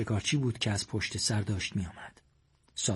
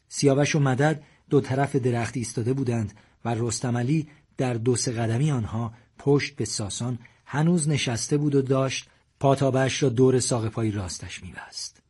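usual speaking voice

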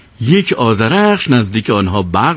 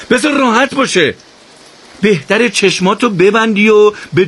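shouting back; 9 semitones higher than usual. Acted. Keramat shouting back at Daniyal, urgent warning. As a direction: yell the warning back down the line. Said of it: "فریاد زد".

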